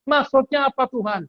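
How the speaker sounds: high, puzzled question; ask, baffled and high-pitched; confused disciple. Retold or imitated. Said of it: "Kalau nggak paham". (murid bingung, nada tinggi)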